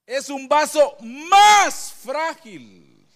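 very slow and loud, stress on más; emphatic verse. Real, not acted. Pastor hammering the Bible verse to the congregation. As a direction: lean on every word of the verse.

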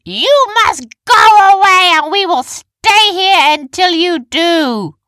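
very high, slow, drawn-out yell; defiant shout. A narrator's reading. Heroine defying the approaching diggers, slow, high shout. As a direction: stretch every word at full volume. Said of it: "she shouted".

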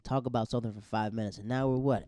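ordinary talk, nothing marked

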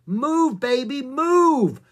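high, loud, drawn-out order; urgent shout. A narrator's reading. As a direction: shout it, stretching the last word. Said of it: "shouted Jack".

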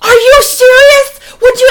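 very high, loud, drawn-out protest; outraged disbelief. Retold or imitated. Son hearing about the vodka girl, imitated outraged disbelief. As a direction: wail it like an appalled teenager.

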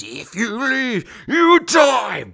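slow, high, stretched-out menace swelling loud; ghostly radio voice. Retold or imitated. Ghost on the radio threatens soldiers, slow eerie wail. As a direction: wail it slowly, ending in a loud high cry. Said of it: "on the radio again, it comes in".

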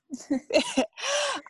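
high-pitched giggle; a quick high laugh. Real, not acted. Laugh in closet.

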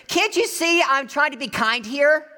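high, loud mock-angry yell; exasperated retort. Helper turning on the yelling woman, exasperated shout. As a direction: yell back in comic exasperation.

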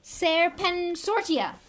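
loud, high, slow bellow of the spell. Acted. Malfoy casting at Harry, a slow bellowed spell. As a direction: boom the incantation out.

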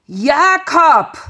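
high, loud furious bellow; roaring. A narrator's reading. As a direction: hurl his brother's name. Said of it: "brüllte Esau".

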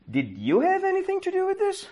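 comic high voice questioning; high suspicious caller. Retold or imitated. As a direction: pitch it up, probing and suspicious.

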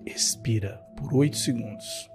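soft, low guided breathing cues; calmly pace the listener's breaths. Acted. Guides breathing meditation over music.

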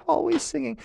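very high, fast laughing voice; high mocking creature voice with a laugh; mocking sea creatures. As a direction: tease the octopus in a squeaky giggle. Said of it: "used to laugh and say".

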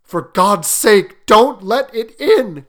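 slow, loud, terrified plea; frightened old man crying out slowly; trembling cry. A narrator's reading. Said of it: "cried the old man, trembling".

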